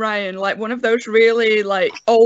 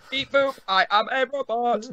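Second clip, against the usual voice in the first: robot voice; 5 dB quieter than usual. Pretend. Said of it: "slightly robotic".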